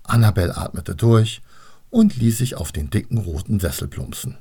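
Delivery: plain reading voice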